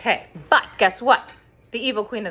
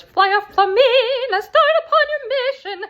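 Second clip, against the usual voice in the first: very high, loud, commanding queen voice; evil queen; 8 dB louder than usual. Retold or imitated. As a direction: boom it out like a wicked queen giving orders. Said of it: "And she goes".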